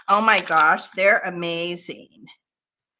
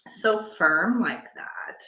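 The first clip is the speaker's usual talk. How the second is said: ordinary talk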